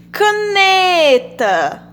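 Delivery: Caneta very slow, long high "ne"; stress on NE in Caneta. Shown as a demonstration. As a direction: stretch the middle syllable of Caneta. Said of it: "Qual a sílaba tônica de caneta?". (Caneta com o "ne" forte e bem alongado)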